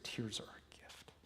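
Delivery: hushed reverent whisper. Real, not acted. Coach honoring a client's tears, whispering. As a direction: breathe it like something sacred.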